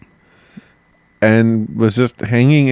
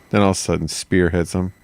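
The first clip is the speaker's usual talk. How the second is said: usual speaking voice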